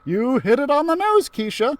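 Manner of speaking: high, bright teacher praise; excited exclaim. Acted. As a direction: cheer her on, delighted. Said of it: "Miss Frizzle exclaimed".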